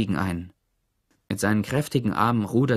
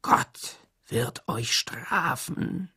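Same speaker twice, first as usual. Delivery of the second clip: slow, venomous whisper; hissed curse; 3 dB quieter, much slower than usual. A narrator's reading. Hattie Parker pointing at the kissing couple, hissing. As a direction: hiss it slowly, all breath.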